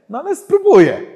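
loud, high-pitched burst; exasperated resolve. Retold or imitated. Preacher retelling his answer to God, loud exasperated resolve.

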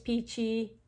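ordinary delivery

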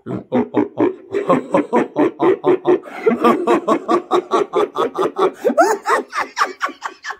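long laugh through a monster effect. Pretend. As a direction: keep the monstrous cackle rolling.

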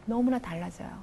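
ordinary delivery

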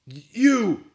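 stuttered, then high loud bellow. A narrator's reading. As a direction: splutter into an angry bellow. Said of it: "he bellowed".

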